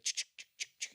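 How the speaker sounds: breathy unvoiced ch-ch-ch like a sparkle sound; whispered magic sound effect. Mocking a modern movie's CGI tree, whispered sparkle noise. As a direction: hiss out a cheesy CGI spell noise.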